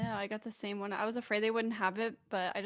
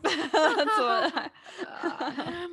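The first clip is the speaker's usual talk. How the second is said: laughing; 6 semitones higher, 6 dB louder, much slower than usual. Real, not acted. Both crack up at joke.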